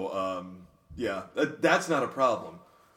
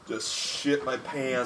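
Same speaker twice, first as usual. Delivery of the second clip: ordinary delivery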